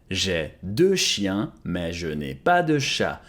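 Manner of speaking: very slow, drawn out, clearly spaced; slow model repeat. Shown as a demonstration. Teacher repeating French for students, very slowly. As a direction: say it very slowly for learners to copy. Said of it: "slow repeat of model".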